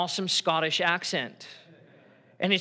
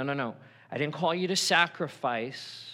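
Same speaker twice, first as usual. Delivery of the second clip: usual speaking voice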